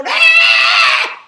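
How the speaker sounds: very high, loud retold scream; piercing mock tantrum yell; imitated child scream. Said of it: "he kicks and he screams".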